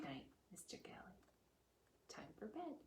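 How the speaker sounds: hushed bedtime voice; soft good night. A narrator's reading. Reader softly bidding the character good night. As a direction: tuck him in gently.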